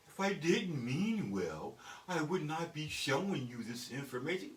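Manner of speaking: slow, carefully enunciated formal phrasing; mock proper talk. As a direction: spell it out politely, word by word. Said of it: "I don't fucking talk like that".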